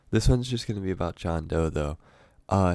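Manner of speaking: normal talking voice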